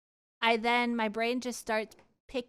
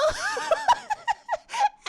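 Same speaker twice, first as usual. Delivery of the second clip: high squealing laugh; 7 dB louder than usual. Real, not acted. Streamer retelling her first kiss, squealing laughter.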